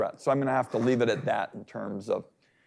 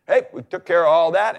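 breezy mock announcement, louder and higher; mock government announcement; 5 semitones higher, 5 dB louder, faster than usual. Senator imitating the government's big announcement, mock announcing. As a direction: lightly voice a quick, raised official announcement. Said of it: "makes a big announcement".

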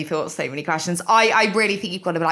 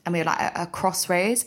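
normal talking voice

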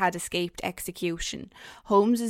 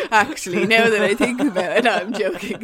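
laughing; 2 semitones higher, 6 dB louder than usual. Real, not acted. Laughing at own joke.

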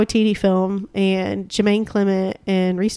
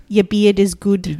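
put-on slow, quoted New Zealand accent; imitated New Zealand accent, unhurried; slower than usual. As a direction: do a New Zealander, drawing the words out. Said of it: "they are from New Zealand".

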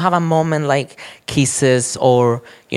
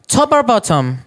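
higher-pitched mock chat-up question; mimicked blunt question; 6 semitones higher than usual. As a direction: imitate an app user's opener, pitched up. Mimicking opening question on Grindr.

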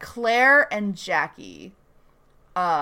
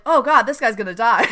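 laughing through it, a bit louder; laughing dismay; 4 semitones higher, 6 dB louder than usual. Retold or imitated. Ando reacting to Peter's plan, laughing.

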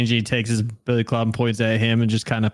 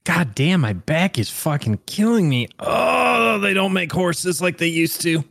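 high-pitched, strained grumbling in character; hammed-up complainer; 7 semitones higher than usual. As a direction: moan theatrically about an aching back. Acted grumbling about back and horses.

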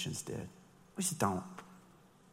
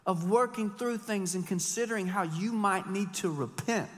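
ordinary talk, nothing marked

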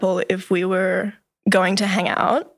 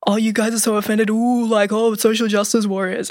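mocking sneer; 2 semitones higher than usual. Dismissive boy mocking the offended friends, sneering. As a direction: scoff at them like a dismissive boy.

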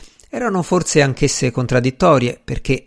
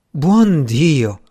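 a touch louder, a mildly alarmed aunt; alarmed exclamation; 3 semitones higher, 4 dB louder than usual. A narrator's reading. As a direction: gasp it out like a startled old lady. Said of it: "dovette limitarsi a esclamazioni come". (esclamazione un po' allarmata)

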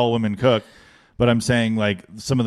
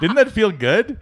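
high laughing question, pleased with herself; 10 semitones higher than usual. Real, not acted. Friend asking her co-host, laughing gleefully. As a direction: giggle it out, delighted.

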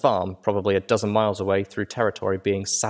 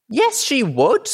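much higher, louder, slow defiant yell; furious filly shout; 6 dB louder, slower than usual. Acted. Filly shouts back at Velvet.

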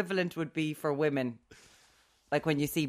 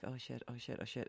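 hushed panic; 5 semitones lower, 18 dB quieter than usual. Retold or imitated. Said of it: "she was like".

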